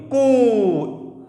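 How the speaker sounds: long high loud कू held three times over; loud long rooster crow; triple-length rooster call. Shown as a demonstration. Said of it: "इससे तीन गुनी ध्वनि".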